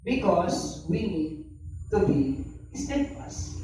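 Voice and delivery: slow, drawn out, each word weighed; slow emphatic preaching. Acted. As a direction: lean on steadfast slowly. Preacher stressing key point. (dahan-dahan at mariing pangangaral)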